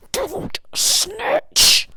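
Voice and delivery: hammed-up high yell of don't snitch; mock shout. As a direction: blurt it out as a squeaky joke outburst. Comic outburst at the helper.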